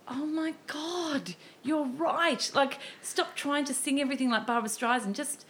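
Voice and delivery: high, slow, awestruck realisation; sudden epiphany. Retold or imitated. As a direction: let the penny drop with wonder. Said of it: "a complete epiphany that I went".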